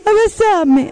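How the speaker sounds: put-on pleading little boy. Little son asking for a hug.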